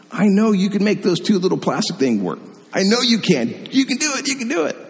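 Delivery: high, playful teasing pep talk; father teasing and cheering his daughter, rising high. Retold or imitated. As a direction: rib her fondly, then cheer her on. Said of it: "One time I even teased her".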